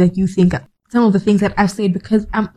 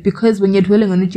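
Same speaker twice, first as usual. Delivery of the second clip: usual speaking voice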